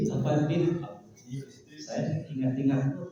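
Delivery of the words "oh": ordinary talk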